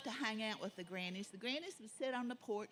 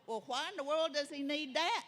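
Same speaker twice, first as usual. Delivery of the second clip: incredulous granny; 6 semitones higher, 3 dB louder than usual. A narrator's reading. Granny asks in disbelief.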